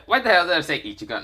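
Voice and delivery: ordinary delivery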